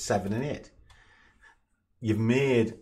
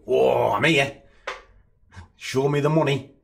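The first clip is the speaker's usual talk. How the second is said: a louder, higher, lightly voiced carefree punter; mock greedy gambler; 5 semitones higher, 5 dB louder than usual. Mocking the gambler who just turns up, hammed-up greed. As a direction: ham up the clueless gambler walking in. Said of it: "Or shall I just go".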